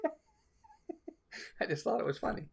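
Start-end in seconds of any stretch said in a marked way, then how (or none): 0.1-1.1 piercing high falsetto, hugely exaggerated; cartoon lady's call. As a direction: call out like a lovestruck cartoon woman chasing her man. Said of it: "and she goes".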